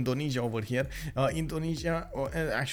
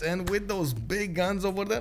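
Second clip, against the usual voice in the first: ordinary talk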